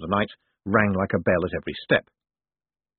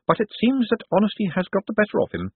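eager secretary; 13 semitones higher than usual. Acted. Drumknott the secretary.

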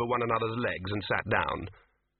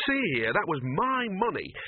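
higher, lightly voiced indignant old wizard; indignant Dean; 8 semitones higher than usual. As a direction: protest the lost money. Dean protests.

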